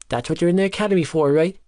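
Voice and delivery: fast, high, furious challenge; furious demand. Acted. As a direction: fire it at him in fury. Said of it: "he demanded, tone furious".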